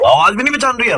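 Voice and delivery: high, loud indignant complaint; annoyed pretend caller. Prank caller loudly scolding the woman for not showing up, high and loud. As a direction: scold her like a fed-up friend waiting.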